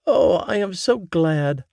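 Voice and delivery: an eager mother waiting for news; mother eager to hear daughter's news; mother's voice. A narrator's reading. Mama voiced in story.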